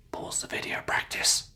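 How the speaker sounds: whispered aside to learners; hushed reminder under the lesson; whispered cue. Shown as a demonstration. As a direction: whisper the instruction softly.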